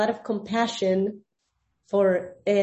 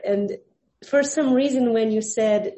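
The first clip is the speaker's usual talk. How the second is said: usual speaking voice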